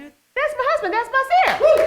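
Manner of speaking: high-pitched, proud announcement; excited squeal of pride; excited shout-out. Real, not acted. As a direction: cheer for your husband, pitch way up. Proudly points out husband to audience.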